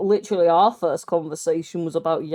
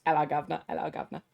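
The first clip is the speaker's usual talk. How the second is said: mock Cockney greeting; 4 semitones lower, 8 dB quieter, faster than usual.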